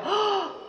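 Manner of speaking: quick shocked intake of breath; a sudden storyteller's gasp; sharp high gasp. Acted. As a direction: gasp as if startled.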